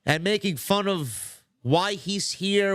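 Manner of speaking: ordinary delivery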